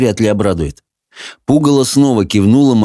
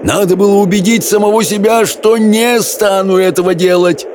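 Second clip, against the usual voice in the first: thundering on; 10 semitones higher than usual. A narrator's reading. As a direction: thunder the confession at raised pitch. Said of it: "прогремел Хоул". (громогласная тирада на высоких тонах)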